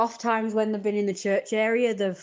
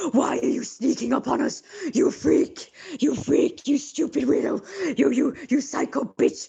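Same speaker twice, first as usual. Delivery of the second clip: put-on high, loud jeering teenager voice, higher; mocking teen taunts; 7 semitones higher, 4 dB louder, slower than usual. Mimicking the teens who shouted at her, higher jeering voice. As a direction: jeer at her like a nasty teenager.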